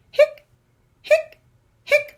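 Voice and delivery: high squeaky hic hic hic; squeaky hiccups. Acted. Skeleton hiccuping to the kids, high squeaky hics.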